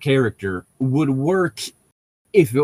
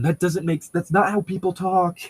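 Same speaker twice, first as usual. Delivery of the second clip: ordinary talk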